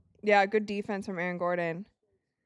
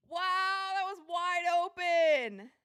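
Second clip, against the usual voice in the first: disbelief; 7 semitones higher, slower than usual. Real, not acted. Repeats missed shot disbelief.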